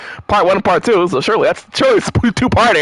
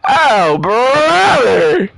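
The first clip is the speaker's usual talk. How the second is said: normal talking voice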